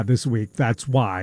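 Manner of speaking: ordinary delivery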